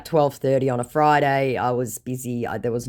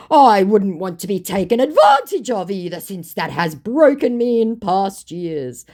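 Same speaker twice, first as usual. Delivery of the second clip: scammer voice; 7 semitones higher, 7 dB louder than usual. Retold or imitated. Voicing scammer's messages.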